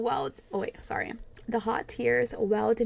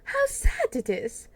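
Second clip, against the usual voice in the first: much higher, louder wail; a higher, louder cry of How sad it is!; anguished cry; 13 semitones higher, 4 dB louder than usual. A narrator's reading. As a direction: cry it out in despair. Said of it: "murmured Dorian Gray".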